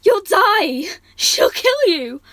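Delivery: high, loud, slow desperate crying shout. Acted. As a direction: cry out in despair. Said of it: "Willow was properly crying".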